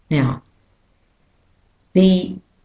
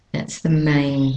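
normal talking voice

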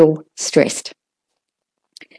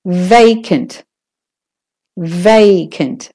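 "vacant" with VAY drawn out; stress on VA; much slower than usual. Shown as a demonstration. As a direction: stretch the first syllable of "vacant", short ending. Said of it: "is the stressed syllable".

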